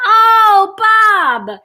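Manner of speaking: high, loud sung-out holler; drawn-out high mock call; grandmother calling out. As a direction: hail a tenant, imitating grandma. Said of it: "She's like".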